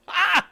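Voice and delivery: high, loud yelp of glee; delighted yelp. Real, not acted. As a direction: let out a sharp happy cry.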